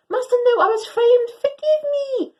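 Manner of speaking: high, loud desperate pleading. Acted. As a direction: beg your master for mercy. Dog pleads with angry master.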